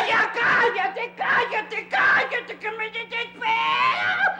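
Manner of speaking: hammed-up, drawn-out exasperated yell; exasperated outburst. Child silencing his accusing playmate, exasperated shouting.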